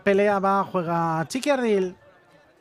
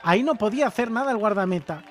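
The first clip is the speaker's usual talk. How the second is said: excited goal commentary; 3 semitones higher, 3 dB louder than usual. Real, not acted. Replay of the goal, still thrilled.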